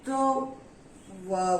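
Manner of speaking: ordinary talk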